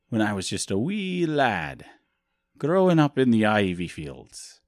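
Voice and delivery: muddled mock Scottish or Irish lilt; bad Scottish-Irish accent. Host reminiscing about his uncle's nickname, bad Scottish-Irish accent. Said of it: "like, a bad Scottish accent or Irish accent".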